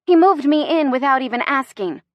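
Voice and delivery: exasperated, aggrieved; indignant complaint. Acted. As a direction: vent to a friend. Complains to friend about boyfriend.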